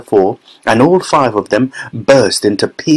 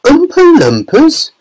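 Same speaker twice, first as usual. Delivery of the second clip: very high, louder incredulous question; astonished chorus; 4 dB louder than usual. A narrator's reading. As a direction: echo it as a baffled crowd would. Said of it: "everyone said at once".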